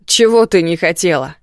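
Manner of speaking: громко, с нажимом на «чего»; рявкни с угрозой; злой крик. Acted. Муж трясёт жену и кричит. (злобный окрик)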